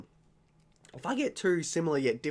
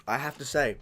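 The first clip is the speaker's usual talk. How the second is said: ordinary delivery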